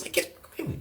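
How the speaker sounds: urgent whisper to a cellmate; secretive urgent whisper. Retold or imitated. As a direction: hiss it so no one hears. Calling him over again secretly.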